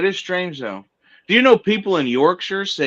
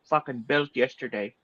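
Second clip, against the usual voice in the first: mock Kiwi accent, "dick" for "deck". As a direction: put on a Kiwi twang for a joke. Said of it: "They say".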